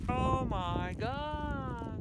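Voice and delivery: loud, high, very slow exclamation; drawn-out amazement. Real, not acted. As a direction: stretch every word in awe. Amazed at all the gulls.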